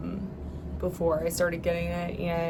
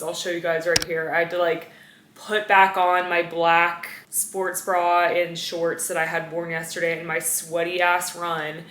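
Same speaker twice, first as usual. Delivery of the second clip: usual speaking voice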